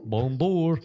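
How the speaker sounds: high hammed-up Cajun Bonjour; mock Cajun accent. Friends trading mock Cajun greetings, high and hammed-up. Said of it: "that's a Cajun person".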